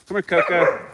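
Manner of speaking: high, loud summons; calling a dog. Real, not acted. Owner calls Coco over at night, calling out. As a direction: call the dog in from the yard.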